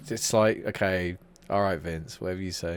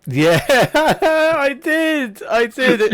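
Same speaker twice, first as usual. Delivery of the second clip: high, loud laughing reply; 12 semitones higher, 6 dB louder, much slower than usual. Real, not acted. Friend laughing at the Undertaker clip. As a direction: laugh through the words.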